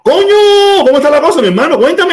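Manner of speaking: a real joyful shout of recognition; delighted surprise. Real, not acted. Host greeting an old neighbour from Cuba, excited shout.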